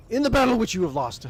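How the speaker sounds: the first overblown try at the line; put-on warrior voice. Game master starting Thorvald's line, hammed-up voice. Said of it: "I'm just gonna talk".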